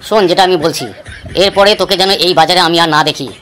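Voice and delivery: a boss passing judgement on a cheat; stern village boss. Acted. Boss Phuto dada gives verdict.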